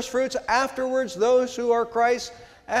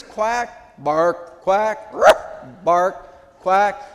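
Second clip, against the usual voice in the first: building quack and bark; 5 dB louder, much slower than usual. Pretend. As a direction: quack and bark trade off, each a little louder. Duck and dog trading noises.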